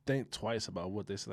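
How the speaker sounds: ordinary delivery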